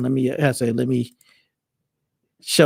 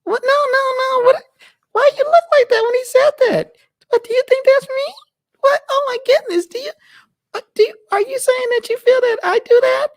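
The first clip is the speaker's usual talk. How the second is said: put-on much higher, louder falsetto girlfriend; high-pitched partner voice; 5 dB louder than usual. Partner watching the stream, in a high falsetto squeal.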